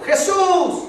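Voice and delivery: high, loud call; crying out. Retold or imitated. Blind man with closed eyes calls Jesus, crying out. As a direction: shout the name out to be heard. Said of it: "dice que dio voces diciendo".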